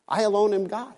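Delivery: I alone am God lifted high and pressed; climbing emphasis on alone. Acted. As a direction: raise the pitch on the repeat. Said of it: "repeated with rising emphasis".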